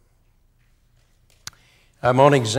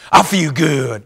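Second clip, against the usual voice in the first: pep talk line; 3 semitones higher, 6 dB louder, slower than usual. Shown as a demonstration. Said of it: "Let's try it one time".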